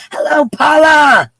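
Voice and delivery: high, loud shouted hello; calling out. Real, not acted. Host spots a friend in chat, shouting a high hello. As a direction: holler it with a long last vowel. Greeting a viewer.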